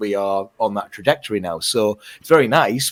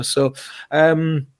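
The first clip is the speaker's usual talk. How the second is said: ordinary talk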